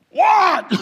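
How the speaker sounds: high, loud shout back from far away; yelled reply. Acted. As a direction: holler it down from a height. Sailor yelling back down from aloft.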